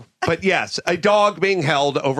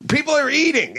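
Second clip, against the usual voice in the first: scolding a dog owner over the lunch table; angry yell; 10 semitones higher than usual. Retold or imitated. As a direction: scold him hard. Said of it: "I yelled at him".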